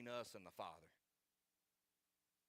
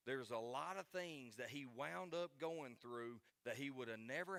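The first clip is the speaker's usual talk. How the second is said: higher, pressing pulpit delivery; forceful preaching; 3 semitones higher, 3 dB louder than usual. Real, not acted. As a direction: preach it with rising urgency.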